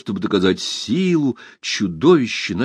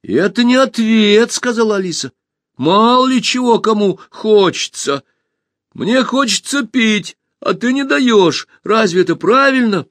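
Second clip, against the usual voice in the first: indignant shout; 10 semitones higher, 4 dB louder than usual. A narrator's reading. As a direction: argue back hard. Alisa argues loudly.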